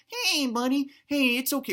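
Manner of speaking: high, cooing pet talk. Acted. Man soothes his grumbling stomach like a pet, high cooing. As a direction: coo up high like greeting a puppy. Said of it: "just go".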